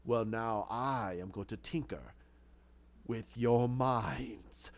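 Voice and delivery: low, slow, deliberate threat; menacing villain. Acted. Hatter about to tinker with Crane's mind, menacing. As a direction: drag out the last words with menace.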